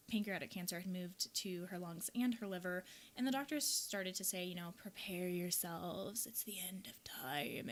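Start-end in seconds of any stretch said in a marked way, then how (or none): 4.8-7.7 slow, hushed whisper of bad news; slow ominous whisper; grim whisper. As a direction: breathe it out like a doctor's warning. Doctors' grim warning, quoted.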